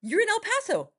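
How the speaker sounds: excited realization. Retold or imitated. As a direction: realize it suddenly, going high. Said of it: "And I said".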